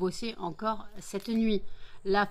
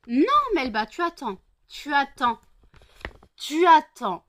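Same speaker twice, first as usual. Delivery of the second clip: much higher and louder, sharp command to the dog; firm scolding; 6 semitones higher, 9 dB louder than usual. Real, not acted. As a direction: tell her to wait, no arguing. Firmly scolding Melba.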